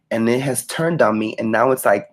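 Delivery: plain reading voice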